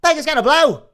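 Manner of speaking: high, loud panicked yell; shouting warning. Acted. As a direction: yell it over the chaos. Panic as robot explodes.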